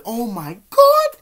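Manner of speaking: high, loud, drawn-out amazement; awestruck exclamation. Retold or imitated. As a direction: re-enact being floored by a beauty. Acting out ogling girls.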